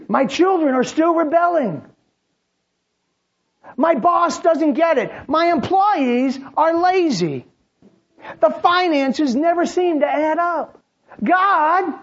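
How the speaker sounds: high, loud whining grievance; mock complaint. A believer griping to God about a spouse, loud and whiny. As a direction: exaggerate the complaint.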